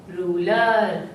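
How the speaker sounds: रूलर with a long drawn-out रू. Shown as a demonstration. Teacher to class, stretching रूलर slowly. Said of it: "बड़े ऊ की मात्रा है".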